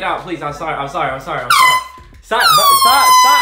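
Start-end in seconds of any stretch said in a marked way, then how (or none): 1.5-3.4 high, loud scream of stop. Real, not acted. Screams while sprayed.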